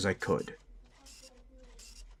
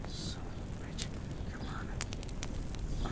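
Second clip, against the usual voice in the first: muttered curse; 13 dB quieter, much slower than usual. Acted. Frustrated under breath.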